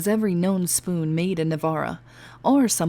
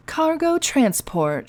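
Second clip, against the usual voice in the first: glowering; 8 semitones higher, much slower than usual. Acted. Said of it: "she glowered at the recruit".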